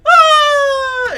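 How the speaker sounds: very high, loud squeal; pretend scream. Character copies the kids' pretend fright, shrieking loudly. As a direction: scream along like the kids. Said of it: "Rini goes".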